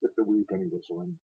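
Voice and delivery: rolling, dramatic ballad reading; a Scots war poem read aloud with feeling; poem recitation. Acted.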